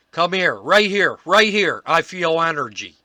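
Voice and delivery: eager investigator summoning his team, loud and high; excited calling out. Retold or imitated. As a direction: call them over like you just felt something. Ron calling out at energy spot.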